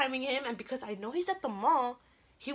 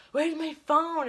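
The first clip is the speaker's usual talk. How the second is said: higher, louder retold panic; mock-frantic, raised voice; frantic searching; 6 semitones higher, 6 dB louder, slower than usual.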